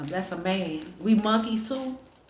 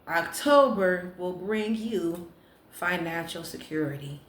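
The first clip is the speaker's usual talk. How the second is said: fortune reading; 3 semitones lower, 3 dB quieter, slower than usual. Acted. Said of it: "your fortune cookie said".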